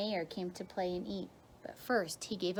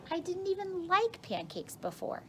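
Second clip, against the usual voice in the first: put-on girl's voice; 10 semitones higher than usual. A narrator's reading. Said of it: "said another girl".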